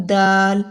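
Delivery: دال said simply, tongue up; plain dal. Shown as a demonstration. Teacher modelling the simple letter دال. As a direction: say دال cleanly and lightly. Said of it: "دال simple".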